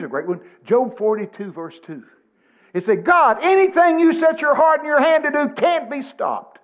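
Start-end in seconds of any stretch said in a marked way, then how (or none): 3.0-6.6 high, loud declaiming of scripture; loud proclamation. Retold or imitated. Preacher quoting Job to the congregation, loud proclamation.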